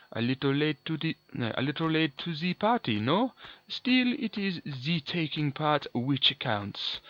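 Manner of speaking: mock French villain, cut off mid-line; terrible French accent. Gamer quoting the villain's famous line in a bad French accent. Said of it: "A terrible French accent".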